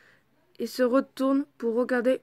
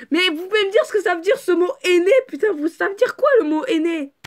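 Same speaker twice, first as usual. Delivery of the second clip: exasperated rant; 6 semitones higher, 7 dB louder than usual. Real, not acted.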